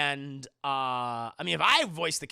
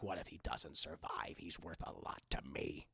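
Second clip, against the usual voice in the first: low, half-whispered gravelly line; low gravel, talking back to Vader; Boba Fett voice; 13 semitones lower, 11 dB quieter than usual. Retold or imitated. Boba Fett talking back to Vader, low gravelly voice. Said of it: "He's like".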